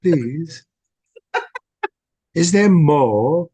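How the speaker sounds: put-on high, loud, slow begging child; Oliver Twist plea. Cohost joins the Oliver Twist bit, high slow plea. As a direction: stretch the plea out like a starving orphan. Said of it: "That's Oliver Twist people".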